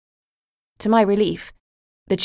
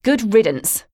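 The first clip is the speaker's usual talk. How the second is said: louder, higher parting shot; loudly; 5 semitones higher, 5 dB louder, slower than usual. Acted. Daughter calling out after the shut door, loudly. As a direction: shout it at the closed door. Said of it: "I said loudly".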